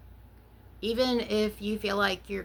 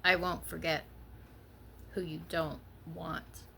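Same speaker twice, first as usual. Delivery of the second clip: measured, lightly performed poem read. Acted. Reads her own poem aloud.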